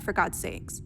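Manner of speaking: voiceless, dropping away at the end; muttered under breath. Acted. Doctor admitting her crush, whispered under breath. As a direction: trail off into an embarrassed whisper.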